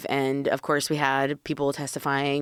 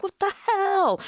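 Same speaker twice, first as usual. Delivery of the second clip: confused viewer; slower than usual. Retold or imitated. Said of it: "aren't like".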